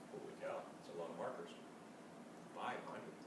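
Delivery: soft, low, quick aside; hushed aside. Real, not acted. Reader marvelling quietly to the children at the markers. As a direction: murmur it under your breath.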